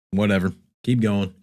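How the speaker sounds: usual speaking voice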